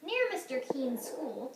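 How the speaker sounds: ordinary delivery, nothing marked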